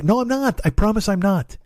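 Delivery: high-pitched retold protest. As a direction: insist you won't, voice jumping up. Acted reply, protesting to Lily.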